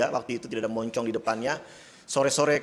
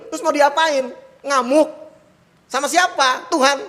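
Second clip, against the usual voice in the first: higher, louder sulky outburst; half-joking rage at God; mock angry outburst; 12 semitones higher, 8 dB louder than usual. Real, not acted. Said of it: "saya ngambek gitu ya". (ngamuk setengah bercanda, lebih keras)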